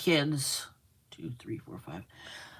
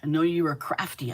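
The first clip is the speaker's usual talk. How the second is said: usual speaking voice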